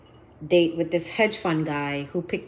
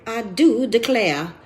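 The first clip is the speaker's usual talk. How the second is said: slow, drawn-out Southern belle "I do declare"; hammed-up Southern belle accent; 4 semitones higher, 4 dB louder, slower than usual. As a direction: put on a syrupy Alabama drawl, slowly. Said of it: "in her true Southern voice".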